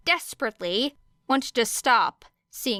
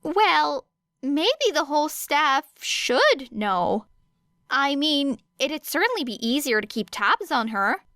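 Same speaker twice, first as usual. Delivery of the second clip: higher, musing suggestion; Hizashi's voice; 6 semitones higher than usual. A narrator's reading. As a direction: lift the pitch, thinking aloud.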